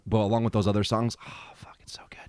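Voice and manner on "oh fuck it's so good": breathy whispered praise; whispering. Real, not acted. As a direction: whisper it like a private thrill. Savouring the song quietly.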